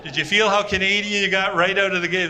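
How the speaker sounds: ordinary delivery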